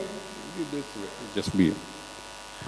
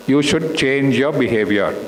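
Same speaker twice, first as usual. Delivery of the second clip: normal talking voice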